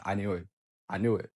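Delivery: low, deflated murmur; dismayed realisation. Retold or imitated. Boyfriend realises she cheated, low dismayed muttering. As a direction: let it sink out softly, to yourself.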